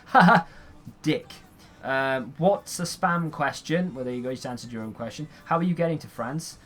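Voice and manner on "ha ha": loud sudden laugh; big real laugh at the screen; burst of laughter. Real, not acted. Gamer laughs at game.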